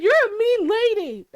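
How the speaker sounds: high, loud imitation of a hurt boyfriend; mock indignant yell; mock wounded outburst. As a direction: blurt it out like an offended man, hammed-up.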